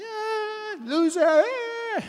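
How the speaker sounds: very high, drawn-out yell; mock whooping. Palestinians charging at wild camels, whooping. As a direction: whoop and jeer like a reckless charge.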